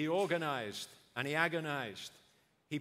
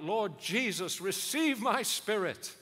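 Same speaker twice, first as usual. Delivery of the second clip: crying out; 6 semitones higher, 8 dB louder, slower than usual. Retold or imitated. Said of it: "he's crying out".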